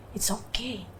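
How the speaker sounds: very soft, hushed soothing; calm a frightened loved one quietly; gentle murmured comfort; retold reassurance. Retells calming frightened husband.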